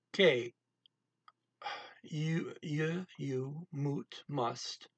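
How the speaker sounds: ordinary talk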